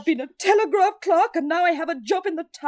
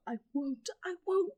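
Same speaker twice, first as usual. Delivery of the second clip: ordinary talk, nothing marked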